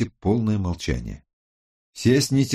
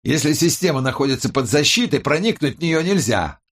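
higher-pitched, emphatic explaining voice; tech expert; 6 semitones higher, 4 dB louder than usual. A narrator's reading. Tech expert answers the criminologist, high and emphatic. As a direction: explain it firmly in a raised woman's voice.

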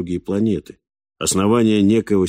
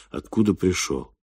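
usual speaking voice

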